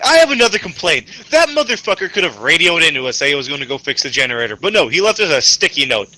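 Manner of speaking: ordinary talk, nothing marked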